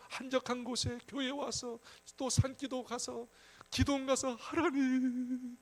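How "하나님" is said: very drawn-out imitated call to God; earnest prayer cry, acted. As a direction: stretch the word into a long pleading cry. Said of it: "그때 간절히 기도할 때".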